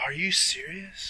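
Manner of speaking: real incredulous whisper; hushed disbelief. Real, not acted. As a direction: whisper it slowly, stunned. Stunned at absurd nomination.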